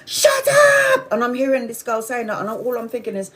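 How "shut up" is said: very high, drawn-out mock screech; hammed-up scream climbing higher; imitated screaming mum. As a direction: imitate the neighbor screeching at her child. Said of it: "screaming at their little".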